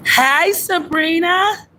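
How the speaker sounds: high, slow, drawn-out hello; singsong greeting. Real, not acted. Streamer greeting a long-unseen viewer, high singsong delight. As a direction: sing the hello upward, stretching it. Greets arriving friend in put-on voice.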